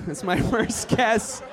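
normal talking voice

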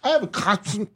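high, put-on goofy voice; silly voice. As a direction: say it like a cartoon kid. Silly made-up word voice.

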